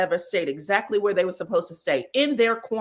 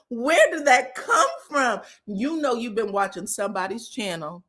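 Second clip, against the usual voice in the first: normal talking voice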